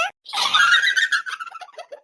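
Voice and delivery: long shrieking cry, falling at the end; drawn-out high bawl; high-pitched wail. Acted. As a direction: melodramatic howl. Mother bawls over ruined sari.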